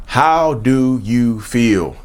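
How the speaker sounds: very slow, pointed asking; slow, deliberate question. Real, not acted. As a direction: draw each word out with weight. Repeats key question slowly.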